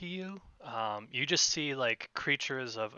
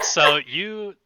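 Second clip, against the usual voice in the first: loud, laughing restart; raised voice, still amused; 5 semitones higher, 13 dB louder than usual. Real, not acted. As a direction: try to carry on through laughter. Resuming narration while still laughing.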